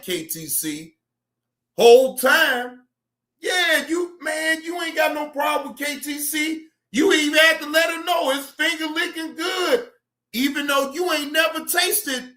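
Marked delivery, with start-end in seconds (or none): 1.8-12.3 raised-voice mocking rant. Real, not acted. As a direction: yell it in disbelief. Ranting loudly at caller.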